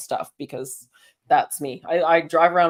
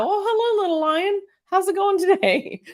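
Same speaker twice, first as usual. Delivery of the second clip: much higher, sing-song, cheerfully greeting a lion; mock-innocent chirpy hello, much higher; naive greeting; 12 semitones higher than usual. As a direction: play the clueless fool saying hi to a lion. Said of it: "you're not gonna go".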